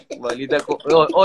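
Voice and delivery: ordinary delivery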